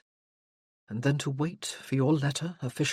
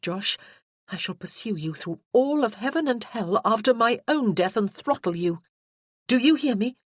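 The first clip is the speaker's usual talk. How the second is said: higher, louder young woman, mock-fierce; Freya's voice; 4 semitones higher, 4 dB louder than usual. Freya vows to throttle Josh if he dies, mock-fierce and higher. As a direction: threaten him half in jest.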